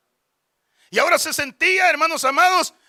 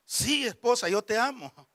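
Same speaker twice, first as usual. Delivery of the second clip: imitated husband; 7 dB quieter than usual. Husband reassuring his wife a second time, soft imitated answer. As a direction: lightly act a patient, quiet answer. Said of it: "Y le vuelve a decir".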